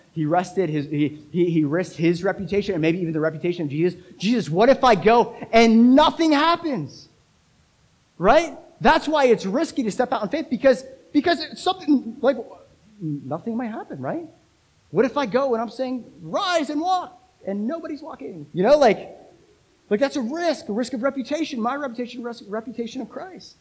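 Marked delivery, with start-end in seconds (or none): 4.2-14.3 high, loud, anguished questioning; forceful preaching. Real, not acted. 14.9-23.6 high-pitched, urgent pulpit delivery; animated preaching. Real, not acted. Preaches with force.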